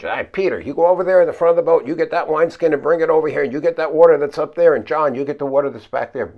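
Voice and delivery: loud, fast barked orders; taking charge. Pretend. Take-charge disciple ordering Peter and John to bail. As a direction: command the crew to bail. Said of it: "maybe you would have taken charge".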